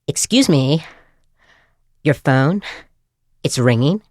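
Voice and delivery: singsong ringtone voice, pausing between phrases; Alicia Silverstone in Clueless. Retold or imitated. Recorded ringtone nagging a stranger to answer, mock Valley girl. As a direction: mimic a prim, insistent recorded voice. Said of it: "similar to the voice of Alicia Silverstone in Clueless".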